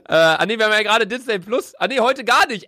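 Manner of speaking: high, fast flustered laughing. Real, not acted. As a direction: giggle through the mix-up. (verlegen lachend)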